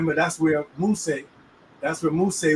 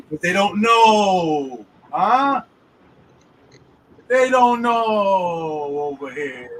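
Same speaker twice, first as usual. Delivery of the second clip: emphatic slow declaration; 3 semitones higher, 4 dB louder, much slower than usual. Real, not acted. Preaching emphasis, loud slow.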